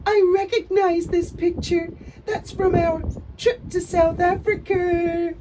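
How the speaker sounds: high, loud, simpering mock voice, slowing at the end; a hammed-up sentimental coo; mock gushing Meghan. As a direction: send up a celebrity cooing over herself. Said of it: "Meghan says, smiling down at it".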